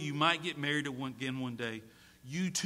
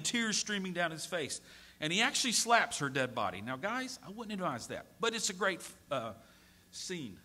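ordinary delivery

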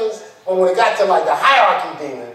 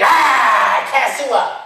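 mocking demon; 7 semitones higher, 3 dB louder, much slower than usual. Demon mocking the exorcism again.

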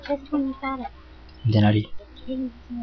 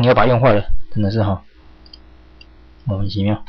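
ordinary talk

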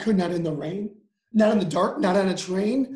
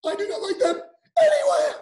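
laughing cry. Acted. Story climax, laughing cry.

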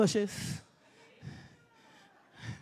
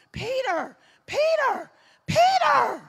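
each Peter higher and louder; rising calls; 13 semitones higher, 8 dB louder, slower than usual. Acted. Preacher crying out Peter's name, rising and louder. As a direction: build the name to a cry.

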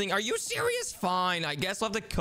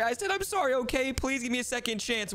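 ordinary talk, nothing marked

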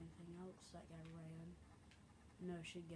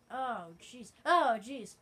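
loud, raised voice, louder the second time; startled exclamation; 5 semitones higher, 15 dB louder, much slower than usual. Real, not acted. Player under sudden attack in game, startled exclamation. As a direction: blurt it out, then louder still.

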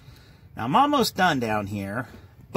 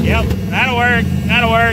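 louder, higher, over engine noise; raised voice; 10 semitones higher, 7 dB louder than usual. Real, not acted. Mechanic to viewers once the engine fires, raised voice. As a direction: call it out over a running motor.

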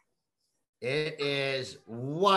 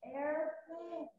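high soft model; 12 semitones higher, 11 dB quieter than usual. Shown as a demonstration. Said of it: "Can you say airplane?".

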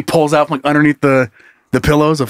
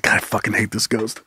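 1 muttered complaint; 6 semitones lower, 8 dB quieter than usual. Acted. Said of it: "He's like".

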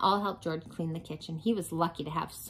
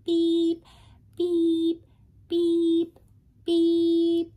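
high, loud beeping truck; truck reversing beeps; 11 semitones higher, 6 dB louder, much slower than usual. Acted. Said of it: "garbage truck voice".

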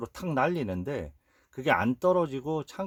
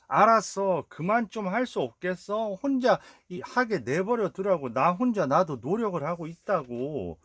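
exasperated outburst; 8 semitones higher than usual. Retold or imitated. Older brother snapping at worried family, half-hearted exasperation. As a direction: push back, fed up with being nagged.

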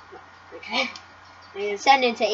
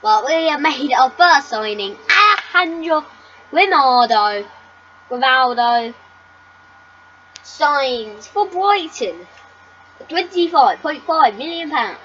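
loud, raised announcer voice; big, higher-pitched proclamation; signing announcement; 4 semitones higher, 10 dB louder than usual. Pretend. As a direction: announce it at full volume like breaking transfer news.

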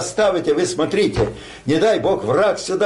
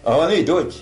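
ordinary talk

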